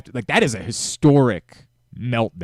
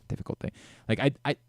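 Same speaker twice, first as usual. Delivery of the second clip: ordinary talk, nothing marked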